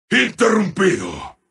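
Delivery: low announcer call, falling away; grave announcer call. Acted. Announcer declaring a streak interrupted, gravely. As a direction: pronounce it low and contained.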